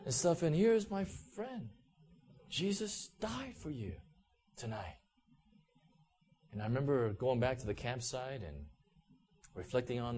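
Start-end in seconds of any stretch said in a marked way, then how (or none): 2.5-5.0 quietly weighted, with pauses; hushed recollection. Retold or imitated. Shouted words recalled as piercing.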